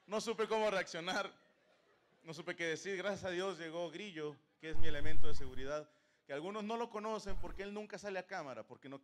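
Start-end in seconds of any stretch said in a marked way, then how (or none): none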